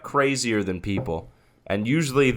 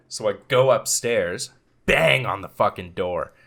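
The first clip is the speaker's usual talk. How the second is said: normal talking voice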